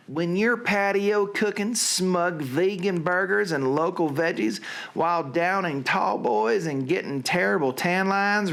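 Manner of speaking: hammed-up Southern recitation. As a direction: deliver it as a mock Southern warning. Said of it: "to do in a Southern accent".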